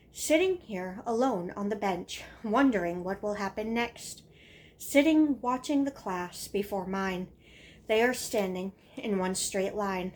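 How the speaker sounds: punchy, emphatic verse in short separated phrases; dramatic reading of a schoolkid's action poem; poem recitation. Acted. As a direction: recite it beat by beat with firm stresses. Recites own childhood poem.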